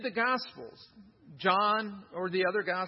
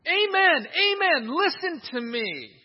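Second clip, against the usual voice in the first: "Amen, amen" called out high and loud, pressing each amen; urgent call to listen, "Amen, amen" ringing out; emphatic amen; 6 semitones higher, 7 dB louder than usual. Retold or imitated. Preacher voicing Jesus urging the crowd, emphatic and loud. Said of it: "What I'm going to tell you is so important".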